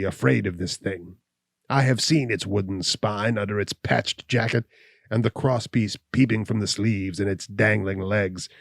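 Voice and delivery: lightly voiced hunchback, taunting his visitor; scornful hunchback Gall. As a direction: sneer at God and fear like a bitter cripple.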